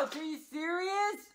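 normal talking voice

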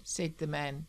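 plain reading voice

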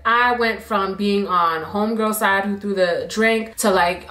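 ordinary talk

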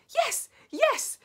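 high, loud insistence; insistent shout. A narrator's reading. First witch insisting to the doubters, shouting. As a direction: shout it back high and urgent. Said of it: "shouted the first witch".

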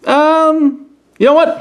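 high eager blurt; sudden excitement. Real, not acted. Reviewer changing plans mid-video, high eager blurt. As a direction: burst out with a new idea.

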